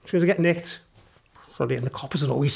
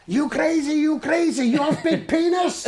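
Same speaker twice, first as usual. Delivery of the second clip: mock Chinese cop; 10 semitones higher, slower than usual. Chinese cops accosting streaker.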